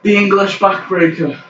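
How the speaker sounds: high-pitched play-by-play of each move; excited wrestling commentary. Pretend. Commentator announcing his old famous move, excited play-by-play. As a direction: build up the next big move.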